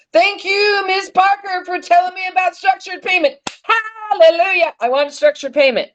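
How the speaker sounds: high, bright-spirited praise; gleeful hallelujah of thanks; joyful thanks. Real, not acted. Thanking Miss Parker, rejoicing.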